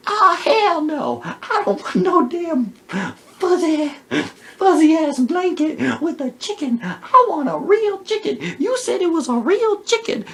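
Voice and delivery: angry high woman. Retold or imitated. Outraged wife refuses the blanket, high sassy voice. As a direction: play the outraged wife demanding real chicken, high and loud.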